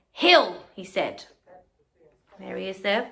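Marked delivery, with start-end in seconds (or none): 0.1-0.7 louder, stern call; stern command. Acted. Wilfred sternly calling his moose, louder. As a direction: bark it like a cross owner. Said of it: "Very cross, trying to be very stern".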